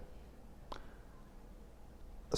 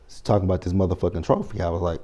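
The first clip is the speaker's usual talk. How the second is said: ordinary delivery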